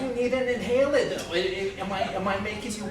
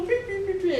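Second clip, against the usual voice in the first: puppy beeping; 10 semitones higher than usual. Pretend. Imitating a puppy's noise.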